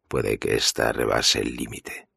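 plain reading voice

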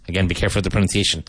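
ordinary talk, nothing marked

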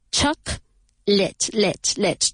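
"Chocolate" broken into CHOC, then LIT; careful choc... lit, lit, with gaps; two separate syllables. Shown as a demonstration. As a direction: isolate and repeat the short "lit" ending of "Chocolate". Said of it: "Two syllables".

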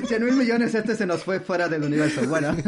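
high laughing disbelief. Real, not acted. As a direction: laugh through the words, amazed. Laughs at absurd guess. (riendo con incredulidad)

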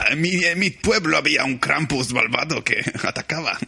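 put-on grandmother with a German accent telling the Krampus legend; old German grandma voice, spooky storyteller. Grandma tells the village Krampus tale, German accent. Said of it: "tiene acento alemán".

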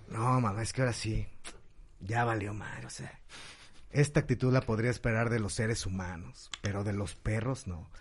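low, quiet mock sulk; understated angry kid, hushed and low; sulking angry boy. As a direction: grumble your disappointment to mom. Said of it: "voltea a ver a Pepito así todo enojado".

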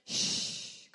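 long breathy "shh" for the Argentine ll and y; airy sh. Shown as a demonstration. Teacher showing the Argentine sound, airy sh. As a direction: hiss "shh" with lots of air. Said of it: "la pronuncies con mucho aire".

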